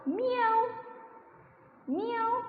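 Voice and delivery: high playful meow; cat meow. Shown as a demonstration. Teacher tells kids what a cat sounds like. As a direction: mew brightly like a kitten. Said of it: "It sounds".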